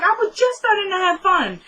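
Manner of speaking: high, put-on child's complaint; complaining kids. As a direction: imitate a disappointed kid protesting. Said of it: "That's what all the kids are doing".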